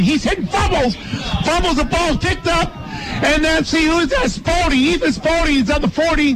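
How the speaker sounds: high excited fumble return call; touchdown call. Real, not acted. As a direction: build as he runs it in.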